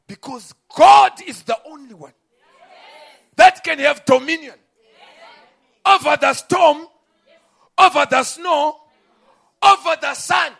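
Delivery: preacher crying out the prophet's words; preaching at full force. Real, not acted. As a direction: command the storm from the pulpit.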